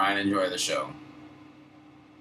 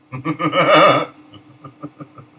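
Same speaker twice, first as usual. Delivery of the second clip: loud laugh; 9 semitones higher, 13 dB louder than usual. Real, not acted. Laughing at his own story.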